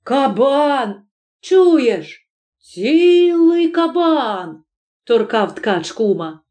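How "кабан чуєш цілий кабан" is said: high, urgent nudge to a crony; thrilled and high-pitched; excited exclamation. Acted. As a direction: stretch the last words in awe. Weaver excitedly nudging his crony.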